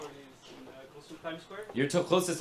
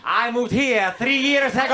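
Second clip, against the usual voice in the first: "I moved here three years ago!" blurted higher and louder with a laugh; excited Italian; 8 semitones higher, 5 dB louder than usual. Retold or imitated. Comic mimicking eager Italians, louder and higher. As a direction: burst out with it, can't help yourself. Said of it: "Then when I be like".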